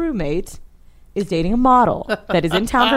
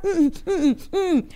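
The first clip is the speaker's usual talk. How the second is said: high, eager closed-mouth whimpers; wordless mumbled assent; 10 semitones higher, much slower than usual. Retold or imitated. Storyteller accepting the offer to sing, high wordless mm-hms. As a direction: hum yes as if unable to speak. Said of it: "I still can't talk".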